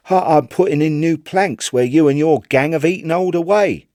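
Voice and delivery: put-on young Chips.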